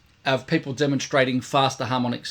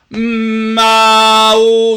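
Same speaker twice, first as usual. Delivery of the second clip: high, loud held "mm-ah-oh"; powerful sustained tone; 8 semitones higher, 13 dB louder, much slower than usual. Shown as a demonstration. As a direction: swell from a hum into a big open vowel. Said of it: "there's so much power and energy there".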